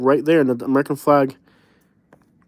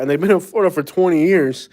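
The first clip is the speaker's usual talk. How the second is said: ordinary talk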